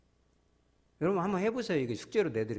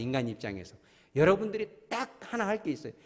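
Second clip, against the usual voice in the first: ordinary talk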